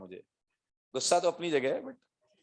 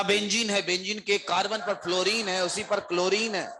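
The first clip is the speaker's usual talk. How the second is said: flustered student; 5 semitones higher than usual. Retold or imitated. Student calling his teacher at 2 a.m., firing off the question in a hurry. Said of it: "इतना भड़भड़ाते हुए बात की उसने".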